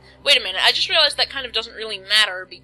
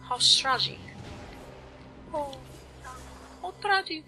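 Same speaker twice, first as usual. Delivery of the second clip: mock-tragic lament in an odd voice; hammed-up, softly pitched-up pity; silly put-on voice; 5 semitones higher, 8 dB quieter than usual. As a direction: lament it in an uncertain character voice, rising higher. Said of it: "what kind of voice I'm supposed to be doing".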